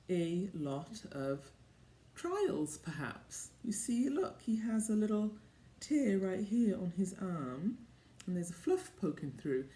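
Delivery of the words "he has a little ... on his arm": plain reading voice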